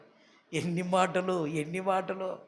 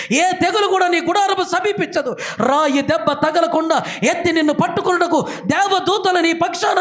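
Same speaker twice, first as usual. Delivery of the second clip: higher, louder rising preacher's litany; preaching at full force; 9 semitones higher, 7 dB louder than usual. Acted. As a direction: build the list like a revival preacher. Impassioned preaching questions.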